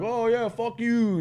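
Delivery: high, drawn-out mocking comeback; sing-song dismissive jeer; retold taunt. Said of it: "And he's like".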